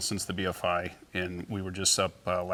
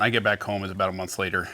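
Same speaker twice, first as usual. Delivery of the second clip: ordinary talk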